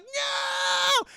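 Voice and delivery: very high, drawn-out mock wail; anguished scream. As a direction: scream in hammed-up horror at a death. Voices Hershey seeing cadet killed.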